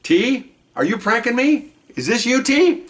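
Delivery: pitched well up, disbelieving a prank call; high, half-laughing suspicion toward a friend; incredulous surprise. Retold or imitated. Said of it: "And what did I say?".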